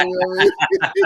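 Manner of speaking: laughing. Real, not acted. Ribbing his uptown friend while laughing through it. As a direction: breaks into a laugh mid-line. Joking about uptown dudes.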